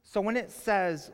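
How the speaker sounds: ordinary talk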